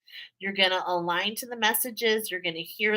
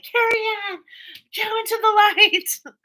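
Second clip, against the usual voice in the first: much higher and louder, a mock spooky call into the light; Poltergeist medium; 14 semitones higher, 7 dB louder than usual. Reader jokingly quotes the Poltergeist movie to her viewers, high mock-spooky call. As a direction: ham up the Poltergeist medium calling the spirit on.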